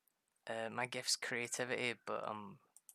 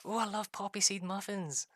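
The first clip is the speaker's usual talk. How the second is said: high, louder mock delight; imitated gushing; 9 semitones higher, 5 dB louder than usual. Husband imitating wife given a muffin, gushing. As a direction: gush like a wife thrilled with her gift. Retelling wife's delight at gifts.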